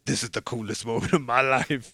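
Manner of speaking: a light put-on action hero voice; playful Wesley Snipes voice; Wesley Snipes as Blade. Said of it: "I'm doing Wesley Snipes".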